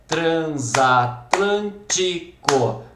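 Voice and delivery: transatlântico very slow, chopped into tran-za-tlân-ti-co; syllable by syllable. Shown as a demonstration. Teacher showing how the sound divides, split syllable by syllable. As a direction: clap out each syllable of transatlântico. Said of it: "Batendo palminha".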